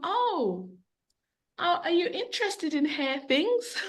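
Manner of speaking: retold tentative approach to a customer; tactful enquiry. Tactfully approaching a stranger's mum.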